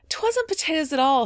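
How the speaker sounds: high, loud, flustered protest; little gasp. A narrator's reading. Polly correcting her mother's mistake, mild gasping protest. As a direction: protest with a touch of breath-caught surprise. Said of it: "with a little gasp".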